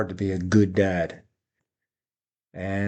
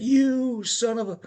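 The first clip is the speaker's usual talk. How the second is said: high, slow, cut-off mock curse, a hammed-up flash of temper; mock outburst; 14 semitones higher than usual. Man losing his temper at the kids, mock curse. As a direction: bite it off halfway like a mock curse. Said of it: "could have lashed out at them".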